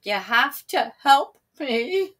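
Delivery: slow, pleading beg; begging. A narrator's reading. Sam begging the villagers to help him. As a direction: beg them to come. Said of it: "Sam begged them".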